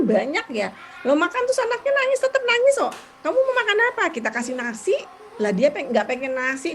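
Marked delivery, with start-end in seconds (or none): 1.1-2.9 nada jauh lebih tinggi dan keras; heboh bercerita. Real, not acted. Anak menangis waktu makan. 3.2-4.0 pertanyaan ke anak, nada jauh lebih tinggi dan agak keras; menirukan orang tua bertanya. Retold or imitated. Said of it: "bukan dia bilang".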